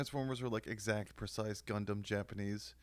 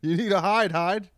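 much higher, loud urgent call; mock warning; 9 semitones higher, 13 dB louder than usual. Friend jokes about the actor, loud mock warning. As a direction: shout the pun like an alarm. Pun called out.

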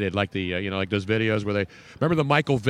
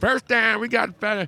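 put-on higher, Southern-accented NFL referee announcing a call; 8 semitones higher than usual. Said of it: "They all sound Southern".

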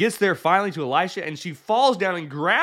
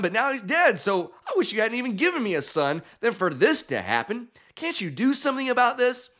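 weeping plea; 9 semitones higher than usual. Retold or imitated. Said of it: "she's weeping and crying".

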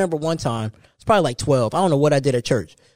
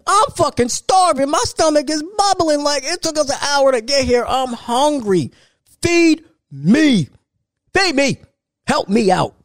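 put-on desperate hungry kid; 9 semitones higher, slower than usual. Kid's inner voice begging.

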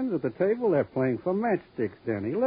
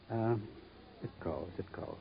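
sighing, soft; 4 semitones lower, 14 dB quieter than usual. Acted. Gangster sighs dismissively.

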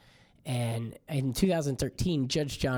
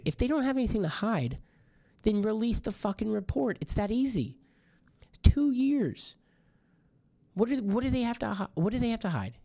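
higher, heated demand building to a near-shout; exasperated rant; 9 semitones higher than usual. Real, not acted. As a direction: vent real frustration at the cover-up. Ranting about the withheld report.

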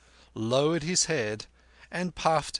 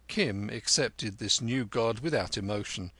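plain reading voice

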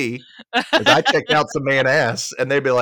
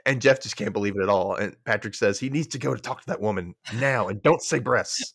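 ordinary delivery

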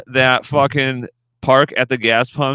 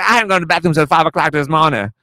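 imitating the lady; 5 semitones higher, faster than usual. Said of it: "I'm doing her voice".

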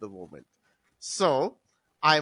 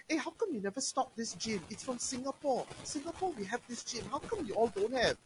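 mocking complaining customers; 7 semitones higher, 10 dB quieter than usual. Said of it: "they were complaining".

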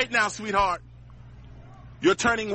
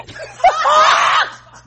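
big shrieking laugh; loud high laughing fit; 10 dB louder than usual. Real, not acted. Mocking laugh at the woman.

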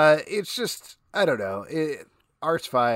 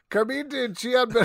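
laughing; 11 semitones higher than usual. Real, not acted. Laughs through the name.

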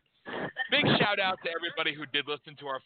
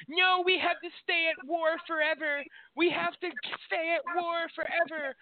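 much higher mocking voice for a war-hungry politician; mocking rhino Republican. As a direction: parody a hawk begging to stay at war. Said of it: "chicken shit little rhino Republicans that".

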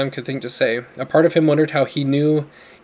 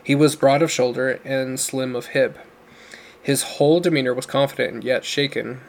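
ordinary delivery

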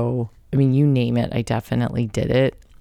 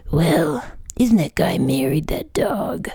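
mock dad whisper; 4 semitones higher than usual. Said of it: "And my dad's like".

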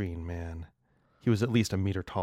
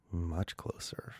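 sultry whisper; 6 dB quieter, slower than usual. Acted. Reader adds a sly aside.

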